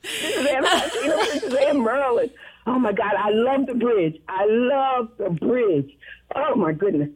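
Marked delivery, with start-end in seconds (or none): 0.0-2.3 laughing. Real, not acted. Caller flustered, laughing at herself.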